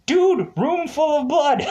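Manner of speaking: high-pitched, laughing disbelief; mock incredulous outburst. Pointing out the plot hole to forgetful characters, high mock disbelief. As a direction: burst out high, breaking into a laugh. Mock exasperation at forgotten blood samples.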